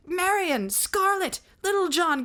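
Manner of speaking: high, loud calling of names; shouting. Acted. Outlaws calling after the legend, high loud shouts. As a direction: cry each name out after them. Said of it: "they shouted".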